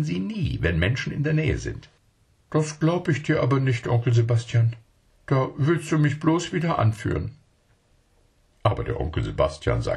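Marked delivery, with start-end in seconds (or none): 2.5-7.3 put-on high, eager little child asking its uncle; a small child not believing a word; child's voice. Child character speaks.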